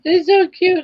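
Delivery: high, loud squeal of delight; squealing how cute the dog is; gushing. Real, not acted.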